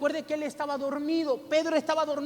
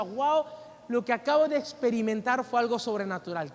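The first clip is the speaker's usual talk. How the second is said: ordinary talk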